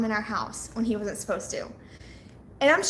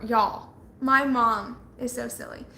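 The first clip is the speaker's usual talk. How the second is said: usual speaking voice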